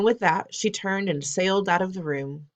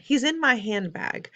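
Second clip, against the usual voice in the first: firm grandmother; 7 semitones higher, 4 dB louder than usual. Acted. Grandmother explains to the Jenkinses.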